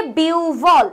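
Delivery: "বিউভল" with a long biu, then a falling bhol; correct pronunciation. Shown as a demonstration. Teacher gives students the right answer, "বিউভল" drawn out as biuu-bhol. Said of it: "একের উত্তর হচ্ছে".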